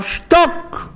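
sudden high-pitched bark of command; imitated shout. Rabbi silencing a crying son at a funeral, imitated shout. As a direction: cut him off with a sharp imitated yell. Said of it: "היה צועק עליו".

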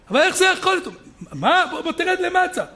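high-pitched incredulous protest; acted disbelief. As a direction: play a visitor who cannot believe it. Acted disbelieving visitor in noisy yeshiva. (חוסר אמון מוצג, בקול גבוה)

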